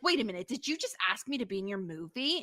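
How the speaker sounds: ordinary talk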